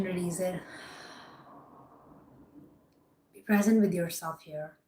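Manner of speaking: soft, unhurried meditation guide; calm them down, pausing between cues; guided meditation. Acted. Meditation guidance, breathing cues.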